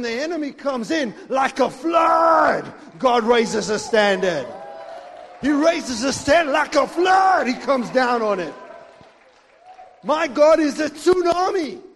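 loud, slow, shouted preaching; full-force preaching. Acted. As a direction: thunder it out from the pulpit.

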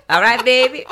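loud, high mock Louisiana voice; over-the-top Louisiana come-on; Louisiana accent. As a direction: belt it out in a jokey Louisiana accent. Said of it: "That Louisiana".

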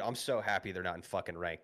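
normal talking voice

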